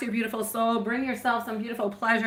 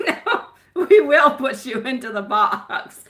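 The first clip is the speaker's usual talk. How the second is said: laughing threat to pet; 3 semitones higher, 7 dB louder, slower than usual. Real, not acted. Playfully threatening her pet.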